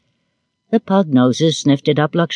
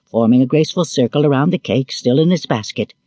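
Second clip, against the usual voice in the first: plain reading voice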